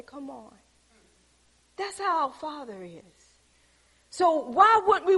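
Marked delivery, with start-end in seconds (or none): 0.0-0.6 soft invitation. Retold or imitated. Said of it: "reach out their hand and say".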